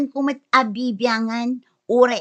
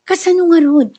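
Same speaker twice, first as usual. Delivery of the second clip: high, loud defensive retort; indignant snap; 6 semitones higher, 7 dB louder than usual. Acted. Wife answering her mother angrily, loud and high. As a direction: bite back at her.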